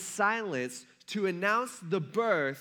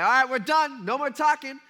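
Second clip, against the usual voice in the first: loud, higher, final scolding; parent shutting kids down; 7 semitones higher, 8 dB louder than usual. Retold or imitated. Fed-up parent to kids in the car, loud scolding. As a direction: slam the conversation shut like a fed-up parent. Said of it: "You're like".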